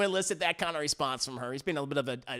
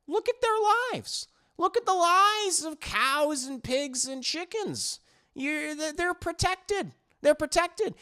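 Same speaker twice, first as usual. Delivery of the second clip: smug alien; 9 semitones higher than usual. Retold or imitated. Alien creature justifying itself to the girl, high-pitched and smug. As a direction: explain it like a kindly, cold-blooded creature.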